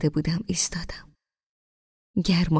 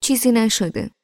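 a touch of impatient brush-off, slightly raised; 6 semitones higher, 4 dB louder than usual. Acted. Narrator as girl, impatiently dismissing Amir, mildly impatient. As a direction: wave it off impatiently.

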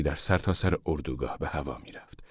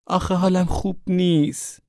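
high, slow, drawn-out whine; plaintive whine; 13 semitones higher than usual. A narrator's reading. Daughter protests to mother that she is unwell, whining. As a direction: whine it as a reluctant, poorly daughter.

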